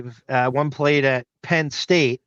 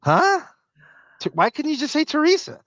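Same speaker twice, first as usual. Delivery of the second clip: mock confused neighbor; 9 semitones higher than usual. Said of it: "and he goes".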